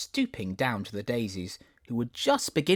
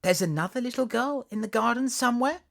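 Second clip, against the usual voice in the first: put-on eager girl; 14 semitones higher, 3 dB louder than usual. Alice hopeful.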